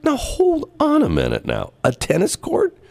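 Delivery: high, disbelieving mock protest; incredulous. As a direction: act out the baffled objection. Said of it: "I'm going like".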